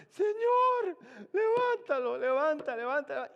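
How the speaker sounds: very high, very loud cry of levántalo; commanding shout. Retold or imitated. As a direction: shout at heaven to raise him. Commanding shout to raise the dead. (grito altísimo de mando)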